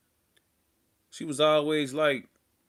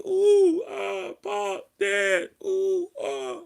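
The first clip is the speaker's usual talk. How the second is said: high, fake cries for dad; mock wailing; 4 dB louder, much slower than usual. Sister crying out to dad while being whooped, mock wail. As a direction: wail it like a faked cry. Sister voiced during beating.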